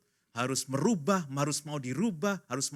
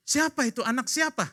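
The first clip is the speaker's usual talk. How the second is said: high, louder mock demand; indignant questioning; 12 semitones higher, 6 dB louder than usual. Coordinator wife questioning Sunday school teacher, indignant. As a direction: demand the culprit's name, hammed up.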